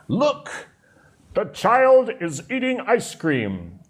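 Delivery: high, slow excited exclamation. Shown as a demonstration. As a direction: read it like an excited cry to a child. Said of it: "such an exclamation".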